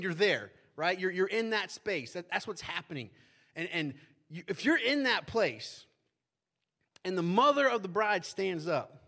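ordinary delivery

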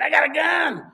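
loud, high imitated threat; mock screaming. Old man to the couple, mock screaming. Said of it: "he's screaming".

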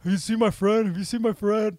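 high, loud, searching call; frantic calling out. Retold or imitated. Drunk man calling around the market for his lost buddy, high loud call. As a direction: call out for a lost friend across the crowd.

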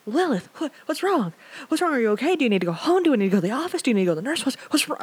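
high, loud, rushed worried questions; panicking friend. Retold or imitated. Classmate panicking over a sobbing Lilith, high and anxious. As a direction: fire off questions at your crying friend in alarm. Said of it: "Like, he's panicking".

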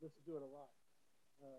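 muffled, fading out; like a call cutting in and out; muffled bass only. Real, not acted. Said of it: "You sound muffled again".

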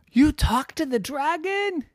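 put-on high, amazed child voice; high-pitched kid disbelief; astonished kid. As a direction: gasp it out in disbelief. Friend's astonished question.

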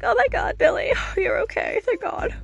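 very high, half-crying imitation; mock tearful relief. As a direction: squeal with hammed-up distraught relief. Retelling Sidney finding Billy alive.

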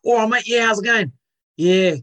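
Cockney cricket fan impression; Cockney accent. Shown as a demonstration. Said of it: "do a cocktail".

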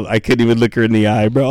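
ordinary delivery

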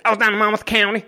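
put-on a rural American accent on Monmouth County, drawled and twangy; country accent. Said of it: "Monmouth County has a country accent".

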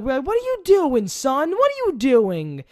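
put-on loud, high, slow scolding old-timer; high and loud, drawn-out scolding of a young driver; scolding Texan. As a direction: lecture the kid like a stern elder. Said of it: "they probably look at you like".

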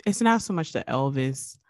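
normal talking voice

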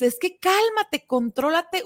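mock the impatient person scolding; scolding order. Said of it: "tú dices".

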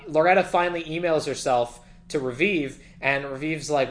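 normal talking voice

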